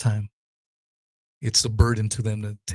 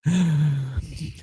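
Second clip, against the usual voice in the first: laugh; 4 semitones higher than usual. Real, not acted. Laughs after rant.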